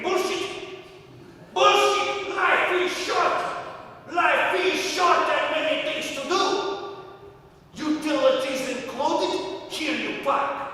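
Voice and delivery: put-on high shouted Russian-accented rant; screaming. Russian landlord yelling at life's sadness, high shouted rant. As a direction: yell it out in defiance. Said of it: "And then I am screaming".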